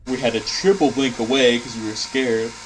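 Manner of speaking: words stretched through a yawn. Real, not acted. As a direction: talk mid-yawn, sleepy. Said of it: "I'm yawning".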